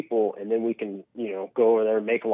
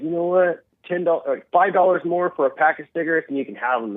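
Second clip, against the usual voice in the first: mock politician; 6 semitones higher than usual. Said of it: "they're like".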